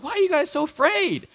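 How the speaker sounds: Jesus asking disciples why they fear; incredulous Jesus. Retold or imitated. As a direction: toss it off half-asleep and baffled. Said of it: "he's just like".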